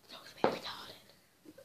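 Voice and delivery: slow hushed whisper aside. Real, not acted. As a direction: whisper it under your breath. Aside remark, unvoiced whisper.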